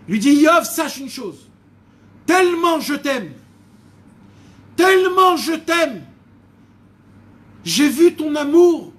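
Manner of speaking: loud, high, fervent voice of God; impassioned preaching. Acted. God declaring his love to Job, loud fervent preaching. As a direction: preach it at full force, swelling with love.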